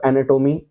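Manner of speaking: एनाटॉमी said "ae-ne-TOH-mee", round o; book pronunciation. Shown as a demonstration. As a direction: say एनाटॉमी with toh, not taw. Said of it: "book में तो टोमी है". (एनाटॉमी का अंत 'टोमी')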